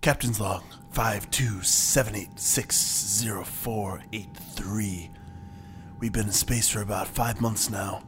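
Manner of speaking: low, hushed log entry; hushed captain's log. Acted. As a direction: murmur the log entry softly.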